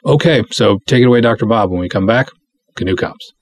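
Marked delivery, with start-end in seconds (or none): none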